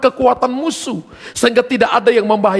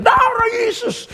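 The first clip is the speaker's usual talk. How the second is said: very high, drawn-out cry; high wailing yell; mock panicked scream; 14 semitones higher, slower than usual. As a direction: imitate a friend screaming as he flees. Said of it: "Dia lari tinggalkan sampai teriak".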